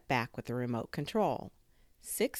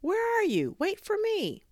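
very high, loud plea; calling out; 7 dB louder than usual. A narrator's reading. Neighbour left behind by speedy friend, high calling out. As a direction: call after someone far ahead. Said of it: "Maria called".

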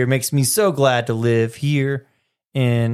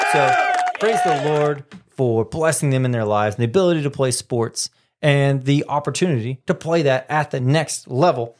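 ordinary talk, nothing marked

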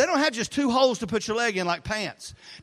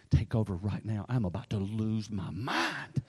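low, slow, much softer, tight; strained plea; 12 semitones lower, 7 dB quieter, slower than usual. Retold or imitated. Pastor begging son to take over, low strained plea. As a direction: say it like you're about to snap. Stressed dad handing over job.

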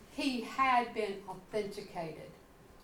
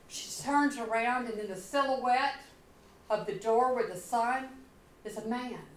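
usual speaking voice

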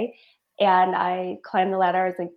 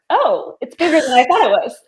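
amazed; 5 semitones higher, 9 dB louder than usual. Retold or imitated. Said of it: "I'm like".

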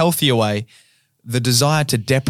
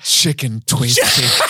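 very slow mock ASMR; exaggerated ASMR snack tease; slower than usual. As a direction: stretch the words out for the microphone. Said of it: "A S M R".

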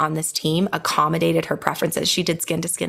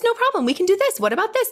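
much higher, sing-song accommodating nurse; eager nurse; 11 semitones higher, faster than usual. Retold or imitated. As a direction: chirp it like a nurse eager to please.

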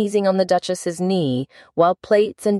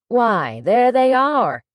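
high, triumphant king, last words drawn out; pleased declaration of victory; 5 semitones higher, slower than usual. A narrator's reading. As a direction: crow it slowly, pitched up, as proof found. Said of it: "said the king triumphantly".

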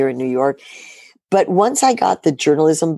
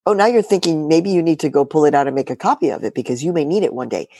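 normal talking voice

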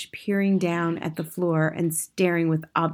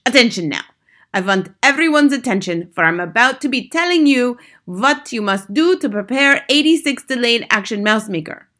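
put-on high, loud accented witch command; imperious Grand High Witch; 6 semitones higher, 7 dB louder than usual. A narrator's reading. Grand High Witch lecturing her witches, imperious and loud. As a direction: order the room about in a witchy accent.

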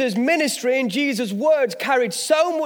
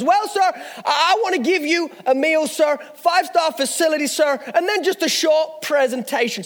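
higher-pitched mock American salesman; amateur American accent; 4 semitones higher than usual. Salesman slips in a short presentation, high mock American accent. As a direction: sell it hard in a hammed-up American accent. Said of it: "now forgive my American accent".

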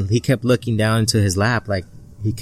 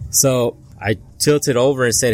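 usual speaking voice

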